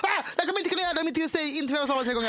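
high, breakneck protest; frantic plea. Retold or imitated. Panicked partner refusing to perform without the ritual, frantic. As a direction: gabble it out in a panic.

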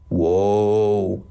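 long-held, intoned exclamation; toned out. Acted. Otto reacting to the fight, intoned. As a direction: draw it out like a laid-back stoner. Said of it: "tont Otto".

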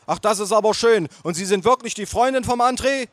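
bellowing aunt. Acted. Aunt to nephew's companion, bellowing. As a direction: demand it loudly as the aunt. Loud aunt questions girlfriend.

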